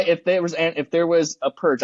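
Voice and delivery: ordinary talk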